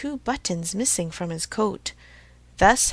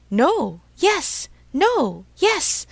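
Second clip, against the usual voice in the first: loud back-and-forth, the yes pitched far higher; angry bickering; 8 semitones higher, 6 dB louder, much slower than usual. A narrator's reading. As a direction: trade short, punchy contradictions. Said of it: "growing angrier each moment".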